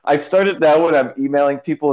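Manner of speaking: ordinary talk, nothing marked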